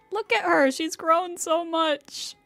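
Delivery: proud gushing. Real, not acted. Streamer gushing over how Kale has grown, proud and tearful. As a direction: gush like a choked-up proud parent.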